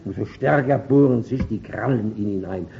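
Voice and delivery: ordinary delivery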